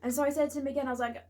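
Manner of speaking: normal talking voice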